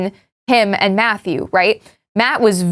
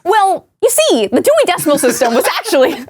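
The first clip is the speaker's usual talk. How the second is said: YouTuber voice; 6 semitones higher than usual. Pretend. YouTuber voice bit continues.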